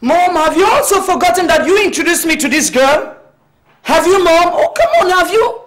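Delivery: put-on a son yelling at his mother that she introduced the girl; angry shouting. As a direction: throw it back in her face.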